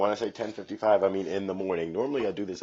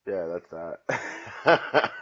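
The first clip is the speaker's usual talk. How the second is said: laughing; 4 dB louder, much slower than usual. Real, not acted. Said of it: "laughing it off".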